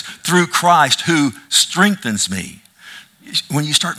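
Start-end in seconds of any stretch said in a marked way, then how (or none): 0.0-2.6 "Through Christ who strengthens me" slow and raised, weight on "Christ"; emphatic. Shown as a demonstration. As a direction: stretch it out word by word, higher, punching "Christ" and "strengthens". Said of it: "There's the emphasis".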